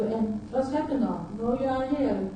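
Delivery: ordinary delivery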